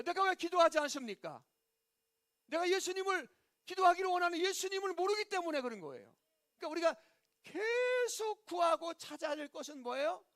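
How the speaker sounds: high, urgent sermon voice without extra volume. Real, not acted. Preacher asking why believers do not pray, high preaching voice. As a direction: press each question home, pitched high.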